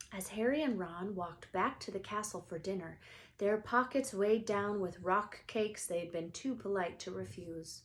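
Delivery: plain reading voice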